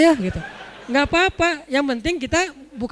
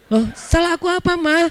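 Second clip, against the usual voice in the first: high-pitched mock innocence; protesting husband; 7 semitones higher than usual. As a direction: plead bafflement to an accusing wife. Husband protests in acted quarrel.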